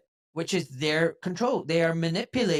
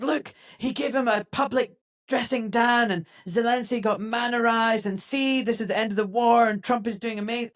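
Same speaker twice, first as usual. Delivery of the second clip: mock excitement; 6 semitones higher than usual. Mimics gushing fans of the showdown.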